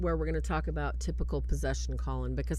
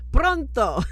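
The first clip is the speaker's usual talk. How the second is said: high, loud put-on Italian pronto; mock priest; 9 semitones higher, 10 dB louder than usual. Priest answering his phone mid-exorcism, hammed-up high voice. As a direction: toss it off like a hurried Italian priest. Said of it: "he's like".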